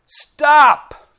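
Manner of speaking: sudden, loud high command; demonstrating a sharp imperative; commanding shout. Shown as a demonstration. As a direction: bark it as an order. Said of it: "Like if I say to you".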